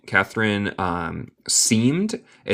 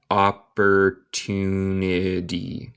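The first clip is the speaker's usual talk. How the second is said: "Opportunity" drawn out very slowly; "Opportunity" said slowly, syllable by syllable; slow pronunciation model; much slower than usual. Shown as a demonstration. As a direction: stretch every syllable of "Opportunity" for a learner. Teacher repeats word slowly for learners.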